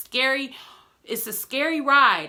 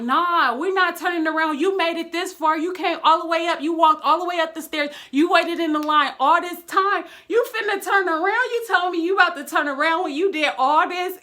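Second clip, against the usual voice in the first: louder and higher, pushing you to stay on the ride; hyped-up friends; 6 semitones higher, 4 dB louder than usual. Retold or imitated. Friends refusing to let the speaker back out of the ride, loud hyped-up insistence. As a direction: egg your scared friend on, loud and insistent. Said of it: "they're like".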